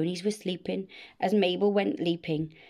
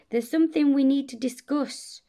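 higher, insistent voice; 6 semitones higher, 4 dB louder than usual. A narrator's reading. Mabel confronts the sleepy dragon, insistent. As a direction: press the point, pitched up.

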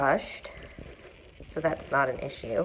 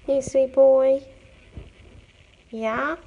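pet talk; 7 semitones higher, 5 dB louder, slower than usual. Real, not acted. Praising cat Bubba.

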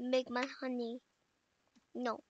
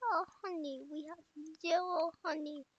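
ordinary delivery

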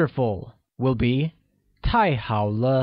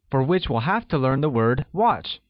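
ordinary talk, nothing marked

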